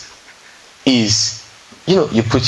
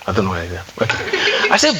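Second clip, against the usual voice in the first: real chuckle breaking through the words; laughing; 6 semitones lower than usual. Real, not acted. Telling the group about nicknaming the husband, laughing. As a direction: laugh it off, then a breathy giggle. Laughing at his own naming.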